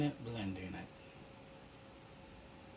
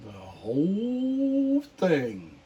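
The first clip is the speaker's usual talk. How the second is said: slow, drawn-out, louder mock groan of a man who ate too much; mock overstuffed groan; 6 semitones higher, 6 dB louder, much slower than usual. Painter jokes to viewers with a mock overfull groan. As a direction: stretch "whole thing" out like a belly-full complaint.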